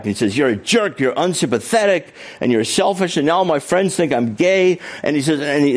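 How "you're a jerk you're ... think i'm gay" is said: retold shouting. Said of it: "Dick started shouting back".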